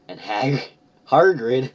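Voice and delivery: loud, high disbelieving squeal; incredulous. Real, not acted. Reacting to misspelled name.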